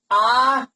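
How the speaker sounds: आ held long; long vowel. Shown as a demonstration. Teacher listing the long vowels, lengthened आ. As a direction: draw आ out. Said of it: "इनमें ज्यादा time लगता है".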